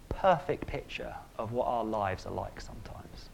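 whispered, drawing the room in; hushed aside. Real, not acted. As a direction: drop to a whisper for the point. Preacher drops to intimate hush.

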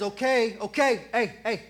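loud, firm call for calm; stern father calling out. Retold or imitated. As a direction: settle the room like a dad at bedtime. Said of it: "Like when a father says".

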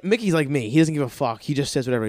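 ordinary talk

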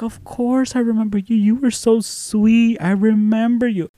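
high, sweet reassurance; imitated tender teacher. Teacher telling former pupil she remembers him, high and tender.

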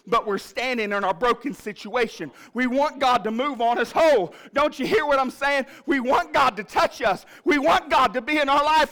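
high, loud, driving sermon cadence; rising preacher's cry; preaching at full force. Acted. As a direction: preach it out to the whole church.

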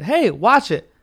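imitated stranger's loud, high warning; mock indignant call. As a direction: call it out like an affronted passerby. Quoting the bumped woman again.

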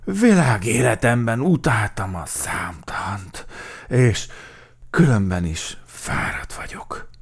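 slow, winded grumble; loud start, tired breathy finish; panting. A narrator's reading. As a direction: heave the words out, breath running away at the end. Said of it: "lihegte Vatkan Valdemár".

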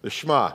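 Shema said as shMAH; "Shema" carefully as shMAH. Shown as a demonstration. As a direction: say Shema with a quick sh into MAH. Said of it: "I try to make sure I say it right".

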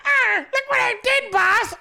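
high, silly eager assistant voice; mock assistant. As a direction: ham up a clueless young assistant. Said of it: "assistant, like".

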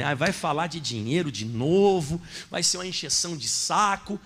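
mock grumbling. Voicing listeners grumbling about money. (resmungo imitado, grave e arrastado)

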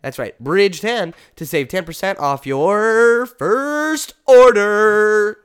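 loud, slow announcer pitch; hyped ad read. Pretend. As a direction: sell it like a hype man. Mock-excited ad read.

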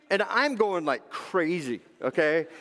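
ordinary talk

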